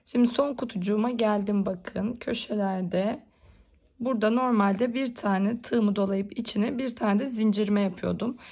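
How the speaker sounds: ordinary talk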